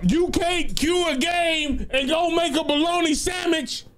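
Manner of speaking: high-pitched mock scolding, pitched well up; mock exasperated rant. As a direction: rant at the killer like he's hopeless. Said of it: "I'm like".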